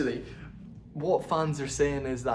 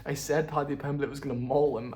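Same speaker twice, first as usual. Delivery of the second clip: usual speaking voice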